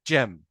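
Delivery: جيم opening on full English "j"; correct jam. Shown as a demonstration. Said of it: "لا، هي بتتنطق جيم".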